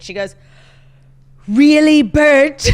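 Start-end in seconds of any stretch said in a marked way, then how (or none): 1.5-2.7 put-on a child's British-accented "Really, Bert?"; imitating a kid's British accent, loud; British accent, loud and bright. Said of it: "kind of, like, a British accent".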